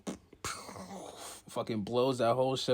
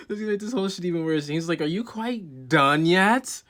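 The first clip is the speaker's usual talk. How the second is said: loud, high, fed-up snap; high, loud, impatient anime argument; high, loud exasperation; 7 semitones higher, 10 dB louder than usual. Retold or imitated. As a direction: pitch up and push the volume. Characters voiced arguing.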